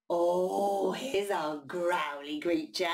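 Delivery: plain reading voice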